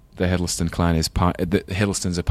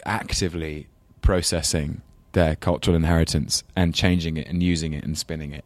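ordinary delivery

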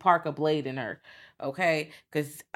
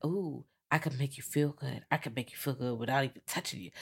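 sultry seductive voice; 2 semitones lower, 8 dB quieter than usual. Retold or imitated. Seductive woman hitting on Will.

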